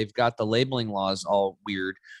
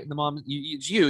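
ordinary delivery

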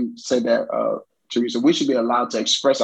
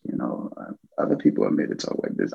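mock meek voice; 4 dB quieter, faster than usual. Speaker mocks people who talk timidly, in a quick whisper. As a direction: drop to a soft whisper, fast. Mocking how others talk.

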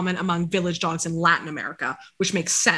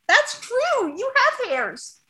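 slow, much higher pet voice; sing-song talk to the dog; slower than usual. Real, not acted. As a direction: coo at him fondly. Baby-talking to the dog.

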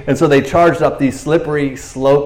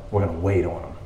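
ordinary delivery

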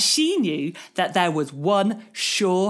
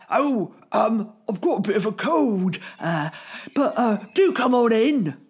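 a lightly voiced sniffly granny; mock croaky granny covering up; wolf faking a cold. Wolf as Grandma making excuses, mock croaky voice. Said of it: "said the wolf, pretending to be Grandma".

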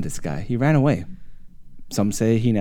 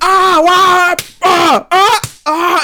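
loud high screams; imitated screaming; 8 dB louder, much slower than usual. Friends being attacked, loud high-pitched screams. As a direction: yell out in acted pain. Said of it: "it's all you start hearing".

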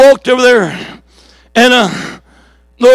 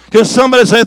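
normal talking voice

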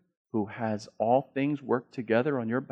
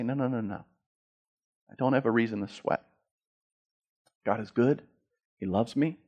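calm self-talk; 2 semitones lower than usual. Acted. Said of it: "voicing calm faith self-talk".

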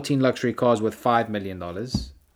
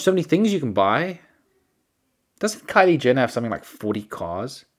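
normal talking voice

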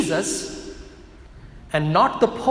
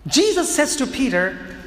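ordinary talk, nothing marked

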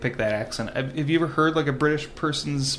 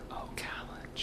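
whispered repeat; 18 dB quieter, slower than usual. Shown as a demonstration. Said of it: "pronounce O's as A's".

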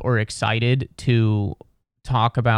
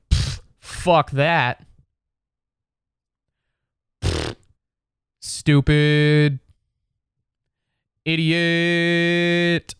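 dismissive scoff; 4 semitones higher, much slower than usual. Retold or imitated. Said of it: "That's kind of the vibe".

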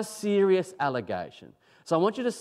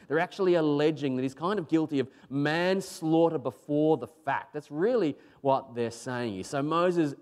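usual speaking voice

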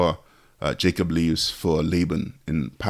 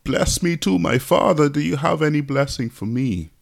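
imitating a crying plea in a higher voice; tearful pleading, retold; 8 semitones higher than usual. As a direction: plead with your father, pitched high, lightly mock-tearful. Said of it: "he's crying. He's like".

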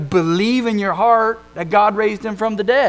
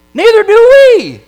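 much higher, loud blurted cry; shouted retort; 15 dB louder than usual. Retold or imitated. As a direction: fire it back as a loud jab.

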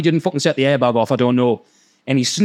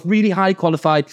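ordinary delivery

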